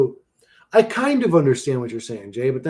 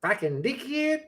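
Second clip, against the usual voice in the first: high, softer Aussie try; attempted Australian accent; 10 semitones higher, 5 dB quieter than usual. Shown as a demonstration. Streamer asking what's wrong with it, Australian accent. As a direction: test the Australian accent, unsure. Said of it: "Which part am I doing wrong?".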